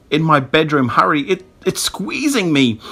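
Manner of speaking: high, strained urgency; choking. A narrator's reading. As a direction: stammer it out while being squeezed. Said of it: "Greg choked".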